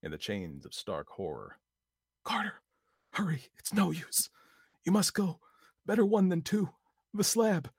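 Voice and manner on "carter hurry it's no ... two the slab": urgent whisper. A narrator's reading. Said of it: "his next whisper".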